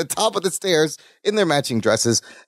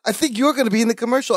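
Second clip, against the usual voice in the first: higher, fast and breathless with excitement; excited Larry; 8 semitones higher, faster than usual. Retold or imitated. Larry tells Balki he'll be in the commercial, excited. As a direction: rush it out, thrilled. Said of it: "He's excited".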